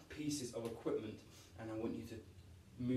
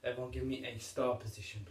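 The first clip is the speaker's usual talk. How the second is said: ordinary talk, nothing marked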